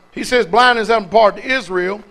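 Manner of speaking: ordinary talk, nothing marked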